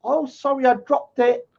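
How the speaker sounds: high, coy mocking falsetto; mock flirty prison voice. Mocking a rival as a coy flirt dropping soap. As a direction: tease him with a coy high voice. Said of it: "Esau used to drop the soap on purpose".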